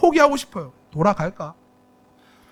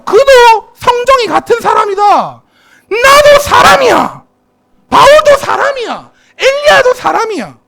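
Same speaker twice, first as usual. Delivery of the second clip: much higher, loud preaching cry; fervent shout; 11 semitones higher, 6 dB louder, slower than usual. Acted. Preacher shouts to the congregation that all are human, fervent shout. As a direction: cry it out with full force.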